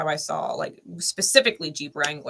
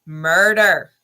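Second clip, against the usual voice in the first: emphatic repeat; 8 dB louder than usual. Real, not acted.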